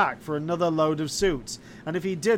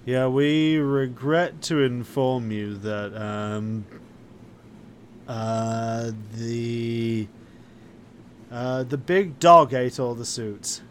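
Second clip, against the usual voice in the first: lower, halting excuse-maker; hesitant dry cleaner; 5 semitones lower, slower than usual. Acted. Dry cleaner breaking bad news to customer, hesitant. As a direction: stall through a bad excuse in a low voice.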